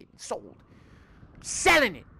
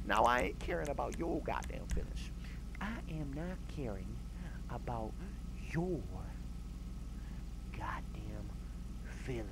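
fading to whisper; 9 semitones lower, 14 dB quieter than usual. Acted. Telling off the car.

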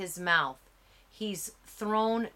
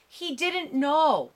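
higher, louder frantic begging; 8 semitones higher, 6 dB louder than usual. A narrator's reading. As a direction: plead for mercy.

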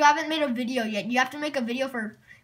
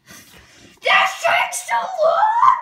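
high, drawn-out frustrated yell; frustrated shout; 6 dB louder, much slower than usual. Real, not acted. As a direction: wail it out slowly, fed up. Frustrated waiting for the catch.

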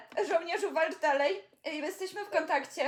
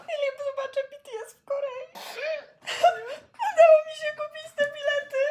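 high teary sobbing joy; happy crying; 5 dB louder than usual. Real, not acted. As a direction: weep with happiness. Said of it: "aż się rozpłakałam". (płacz ze szczęścia)

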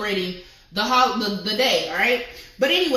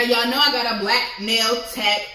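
ordinary talk

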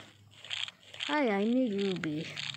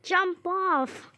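shouting; 7 semitones higher, 4 dB louder than usual. Real, not acted. Yelling at game character.

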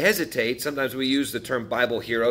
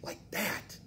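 breathy whisper, much softer and higher; whispered aside; 8 semitones higher, 14 dB quieter than usual. Shown as a demonstration. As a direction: drop it to a quick whisper, like a snap. Dying in an instant.

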